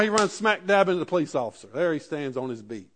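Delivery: ordinary talk, nothing marked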